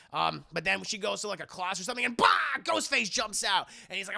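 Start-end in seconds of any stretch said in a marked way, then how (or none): 2.2-2.3 sudden shouted mock bah; jump-scare yell. Killer jumping out of the closet, startling. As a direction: burst out with a boo-like yell.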